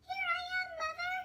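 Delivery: high chick voice calling up; baby bird calling out. Acted. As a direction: call up to the plane, tiny voice. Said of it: "he called out".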